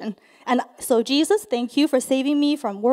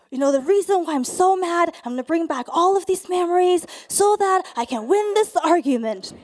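loud, insistent venting; hammed-up rant listing every grievance; really mad; 3 semitones higher, 5 dB louder than usual. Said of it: "makes me really, really mad".

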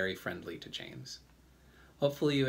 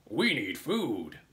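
slow, put-on centipede voice; centipede character; slower than usual. A narrator's reading. Centipede speaks up for the hungry bugs, slow emphatic demand. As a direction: draw it out as a hungry bug. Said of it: "he said".

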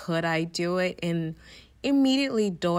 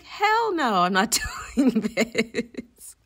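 much higher, louder, laughing no way; emphatic refusal; 5 semitones higher, 5 dB louder, slower than usual. Retold or imitated. Hearing it's 800 kilometers, laughing flat refusal. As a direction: refuse it outright, breaking into a laugh. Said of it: "I was like".

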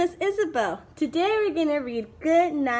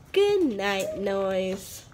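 gentle goodnight; 7 semitones lower, much slower than usual. Acted. Owl falling asleep gently.

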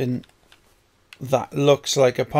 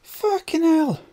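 much higher, strained cry of frustration; high exasperated curse; 14 semitones higher than usual. Real, not acted. At the game refusing to let him climb, a high exasperated cry. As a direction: squeal it in disbelief. Character stuck again.

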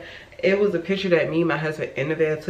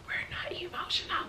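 whisper; 13 semitones higher, 16 dB quieter, faster than usual. Acted. Jokey denial after being called emotional.

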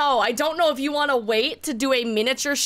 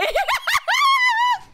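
high screaming laugh, much higher and louder; shrieking laugh; 4 dB louder than usual. Real, not acted. Laughing at the password joke.

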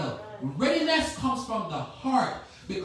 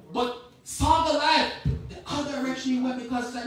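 usual speaking voice

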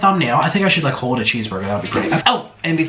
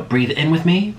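calm meditation coach voice; guided breathing. Acted. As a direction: lead them gently through each breath. Leads deep breathing.

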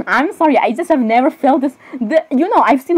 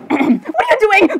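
high, drawn-out mock-shocked question; startled outcry; 9 semitones higher, slower than usual. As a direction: squeal the question in drawn-out disbelief. Acted outburst at another character.

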